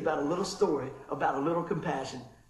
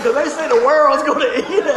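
laughing; 6 semitones higher, 11 dB louder than usual. Retold or imitated. Retelling clerk, still laughing.

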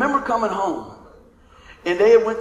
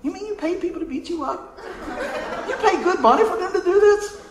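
high, far-off mocking dad voice; dad's voice; 11 semitones higher than usual. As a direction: play a baffled father scolding. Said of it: "my dad's voice in my head".

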